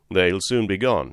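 ordinary delivery

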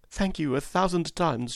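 put-on high-pitched grateful young woman; heartfelt thanks. Mother thanks her helper.

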